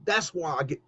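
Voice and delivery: normal talking voice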